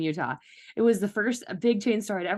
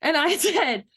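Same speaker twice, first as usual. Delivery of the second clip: surprised; 8 semitones higher, 4 dB louder than usual. Retold or imitated. Retelling a surprising yes.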